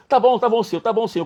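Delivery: high, hurried yielding; acted-out scrambling agreement; flustered compliance. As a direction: give in quickly under pressure. Acts his flustered hurried assent.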